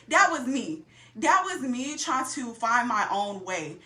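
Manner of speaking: ordinary talk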